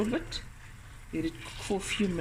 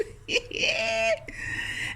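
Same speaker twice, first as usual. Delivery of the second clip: delighted laugh. Real, not acted. Delighted after tasting.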